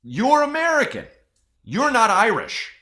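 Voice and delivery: very high, loud comic outrage; mock-indignant yell; exasperated insistence. Escalating rant against the Irish claim.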